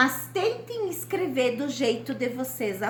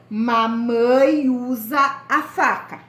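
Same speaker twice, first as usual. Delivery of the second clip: put-on slow, louder, each word set apart for the children; slow dictation; 5 dB louder, slower than usual. Shown as a demonstration. Teacher dictating the example sentence to her pupils, slow and louder. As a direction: spell "mamãe usa a faca" out slowly, word by word. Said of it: "Uma frase pra faca".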